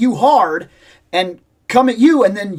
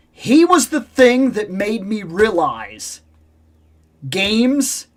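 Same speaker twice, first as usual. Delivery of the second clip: ordinary delivery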